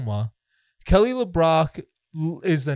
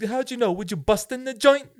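higher, hammed-up Hollywood Italian accent; 7 semitones higher than usual. Quoting the bar scene from Out for Justice, in a mock Hollywood Italian accent. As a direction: ask it like a movie wiseguy in a Hollywood Italian accent. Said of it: "the overly Hollywood Italian accents".

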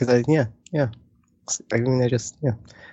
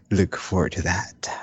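jazz radio voice; 4 dB quieter than usual. Pretend. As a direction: croon it like a jazz DJ. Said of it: "my nice, calm jazz radio voice".

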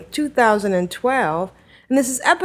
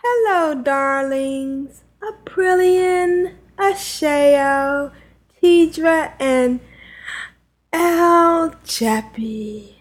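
Juno; 3 semitones higher, slower than usual. Acted. Host reads absent Juno's letter aloud, impersonation. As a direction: greet grandly, stretching each name slowly. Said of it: "She does a great Juno voice".

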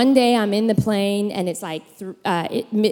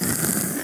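soft snoring noise; quiet mock snore; imitated snore; 9 dB quieter than usual. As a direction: rasp like a sleeping husband. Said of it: "He's like".

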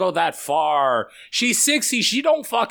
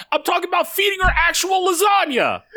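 exasperated shout; 14 semitones higher than usual. Real, not acted. Friend correcting the lasagna misunderstanding, laughing shout. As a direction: shout it through laughter, fed up.

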